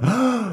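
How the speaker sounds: high mock gasp; acted gasp of shock. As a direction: imitate a crowd's sharp intake of breath. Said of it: "everybody just saw this tackle".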